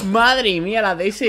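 high, loud and amazed. Real, not acted. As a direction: exclaim it in awe. Amazed at the shot.

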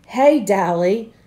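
loud, drawn-out happy hail; excited greeting. A narrator's reading. Waking boy greets his friend Dally, excited. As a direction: call out gladly to a friend, stretching it.

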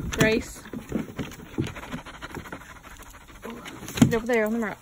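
loud firm command to the dog. Real, not acted. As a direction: tell the dog where to go. Ordering the dog Grace.

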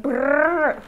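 playful brr of cold lips; mock shivering brrr. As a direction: shiver out a lip trill. Imitating cold weather shiver.

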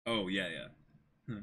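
usual speaking voice

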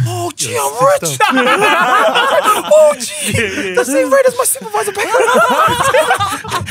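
high-pitched laughing mock joy; laughing disbelief. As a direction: squeal through laughter at the pay. Retelling joy at big pay rate.

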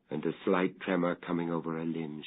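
usual speaking voice

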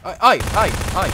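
high panicked yelps; startled yelp. Real, not acted. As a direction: yelp in sudden fright. Startled in horror game.

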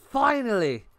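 high, elated cry of relief; triumphant. Real, not acted. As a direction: let it burst out after a long grind. Celebrating reaching Diamond.

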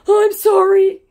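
very high, loud mock apology; apologizing scammer. Scammer answering the insults, very high mock apology. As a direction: squeal it as a sorry scammer, hammed up. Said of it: "he said".

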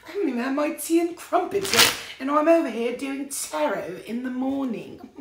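mock upper-class English, all tea and crumpets; posh British accent. Doing a posh British voice for her viewers. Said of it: "I just did, like, five accents at once".

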